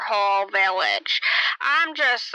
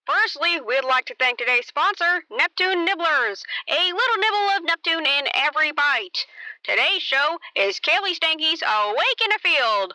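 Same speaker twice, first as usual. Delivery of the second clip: higher, bright show-host read with a sponsor plug; radio announcer; 8 semitones higher than usual. Acted. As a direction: open the broadcast like an old radio host.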